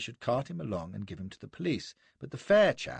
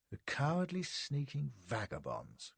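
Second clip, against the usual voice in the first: drawn-out, restrained outrage; understated murderous indignation; indignant cry; 4 semitones higher, 6 dB quieter than usual. A narrator's reading. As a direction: spit out the insult slowly. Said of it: "cries he".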